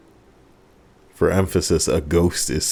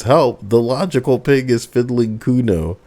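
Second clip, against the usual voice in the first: snorting yell; 6 semitones higher than usual. Acted. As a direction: snort and then yell it mockingly. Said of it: "He snorts".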